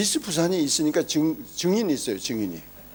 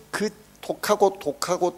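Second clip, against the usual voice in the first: normal talking voice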